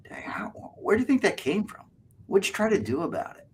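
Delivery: pretend-intrigued whisper; eager whisper. Shown as a demonstration. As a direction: whisper like you're hooked on the story. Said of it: "pretend like you're really into it".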